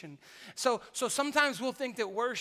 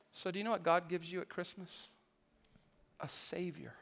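normal talking voice

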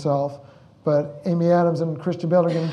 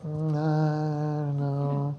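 slow, soft and drawn-out uncertainty; driving in to work, musing slowly and doubtfully; hesitant doubt; 4 dB quieter, much slower than usual. Retold or imitated. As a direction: let the doubt hang. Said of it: "I go".

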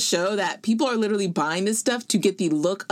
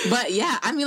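high laugh; 4 semitones higher than usual. Real, not acted. Laughing at reporter voice.

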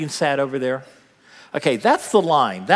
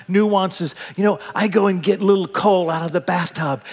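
ordinary talk, nothing marked

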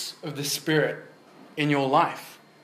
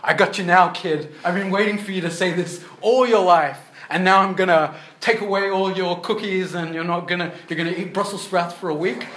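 higher, louder gloating villain; sneering panto villain; 4 semitones higher, 5 dB louder than usual. Pretend. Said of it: "What kind of parent would say".

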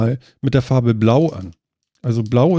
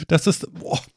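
mouth burning from spicy food; 5 semitones higher, 4 dB quieter, slower than usual. Real, not acted. Spicy pepper candy just eaten.

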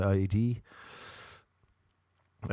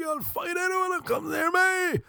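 much higher, mechanical shouted threat; robot voice threat. Retold or imitated. Robot-voiced DMV woman threatens everyone, high mechanical voice. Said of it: "a woman in there with a robot voice".

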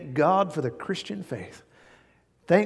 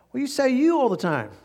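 wife complaining; 9 semitones higher than usual. Retold or imitated. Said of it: "I said / she said".

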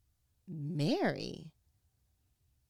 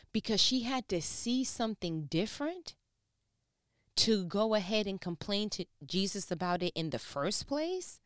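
higher and louder, fired up; excited preaching; 5 semitones higher, 4 dB louder than usual. Real, not acted. Preacher praises God for Martha's sister, higher, louder. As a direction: let the excitement lift you up.

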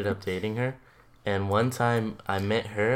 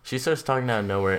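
normal talking voice

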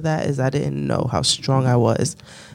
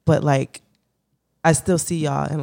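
usual speaking voice

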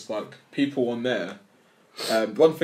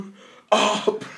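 mock shout; 9 semitones higher, 3 dB louder than usual. Mocking loud retort to reality star.

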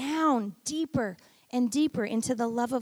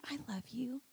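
unvoiced, much softer whisper; 2 semitones lower, 13 dB quieter than usual. God telling pastor he loves her, whispered. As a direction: breathe it like a secret. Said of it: "God was going out of his way to just say".

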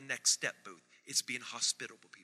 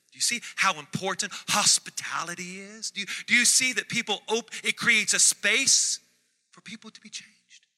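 rising, louder preacher's plea with a laugh; preaching climax; 5 semitones higher, 7 dB louder than usual. Real, not acted. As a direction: build the crowd up, pleading and excited.

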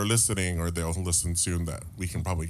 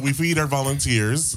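ordinary delivery

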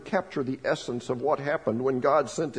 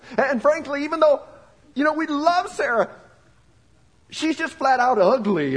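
laughing; 11 semitones higher, 5 dB louder than usual. Pretend. Parent voice, laughing at the line.

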